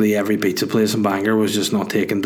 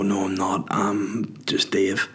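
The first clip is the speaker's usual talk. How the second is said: soft, half-whispered mock modesty; meek denial; 2 semitones lower, 6 dB quieter, slower than usual. Comedian correcting a fan's imagined mistake, meek and hushed. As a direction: trail down into a slow, quiet admission.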